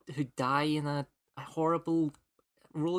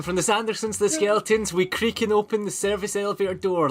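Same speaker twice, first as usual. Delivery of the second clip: excited; 6 semitones higher, 7 dB louder than usual. Acted. Spooky podcast intro, hammed up.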